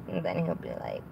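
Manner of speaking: ordinary talk, nothing marked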